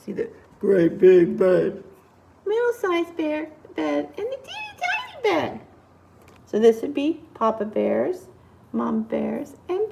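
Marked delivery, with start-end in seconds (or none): 0.6-1.8 deep, weighty storyteller voice; big low voice for something enormous; great big voice. A narrator's reading. Voices the big bed's size. 4.2-5.6 soft, high squeak; tiny high-pitched voice; teeny tiny voice. A narrator's reading. Voices the tiny bed's size.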